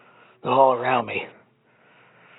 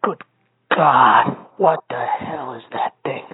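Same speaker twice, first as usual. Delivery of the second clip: frightened whisper; 3 semitones lower, much faster than usual. Acted. Terrified under breath.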